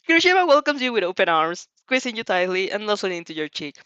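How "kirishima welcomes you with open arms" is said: higher, louder, laughing through words; crying with laughter; words broken by laughter. Real, not acted.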